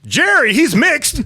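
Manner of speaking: high, drawn-out, loud mock yell; heckler's shout. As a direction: yell it across the room like a heckler. Heckler shouts across the club.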